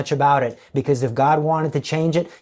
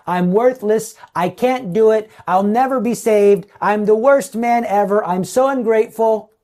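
a higher, louder imitation of a discouraged sinner, lightly played; mock despairing sinner; 7 semitones higher, 5 dB louder than usual. Discouraged sinner lamenting to himself, mock despair. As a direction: voice the sinner who has given up on himself, a bit hammed-up. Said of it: "They say".

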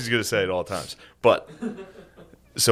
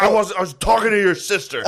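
high, flustered mock anger; imitating someone angrily covering his tracks; angry deflecting; 8 semitones higher than usual. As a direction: backpedal while still mad. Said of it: "while angry, he had to deflect".

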